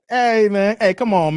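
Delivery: normal talking voice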